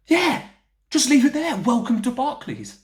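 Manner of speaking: high, loud mocking call; mock shout. Mocking drunks who throw up in bank lobbies, high mock shout.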